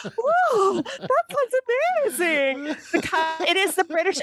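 high-pitched, gushing amazement; mock client awe. Clients swooning over British counterpart's pitch, mock awe. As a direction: gush like an easily dazzled client. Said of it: "have them be like".

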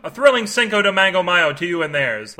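jokey announcer welcome; hyped podcast intro. Acted. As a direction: sell the opening like a radio host. Podcast host's upbeat opening.

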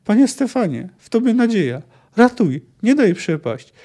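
high, desperate begging; panicky, pleading appeal; babbled plea. A narrator's reading. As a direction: beg to be saved. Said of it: "wybełkotał doktor Silifant".

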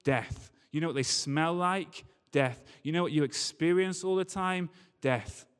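ordinary delivery

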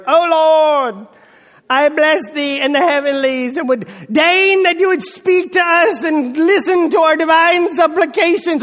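high, loud, drawn-out theatrical prayer; thespian praying. Acted. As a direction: put on a grand stage-actor accent to pray. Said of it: "where'd your accent come from".

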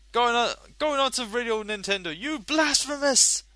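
high, loud mock zealot voice; hammed-up outraged zealot; playful monster chase.

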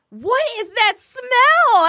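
very high, loud and drawn-out; mock outraged outcry. Farter pretending shock at her own smell, mock outcry. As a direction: stretch every word in exaggerated disgust.

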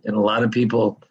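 ordinary talk, nothing marked

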